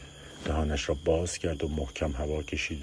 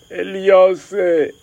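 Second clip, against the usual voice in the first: high loud slow sobbing call; sobbing shout; 16 dB louder, much slower than usual. Acted. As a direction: wail the name through sobs. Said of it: "به حق حق افتاد و داد کشید".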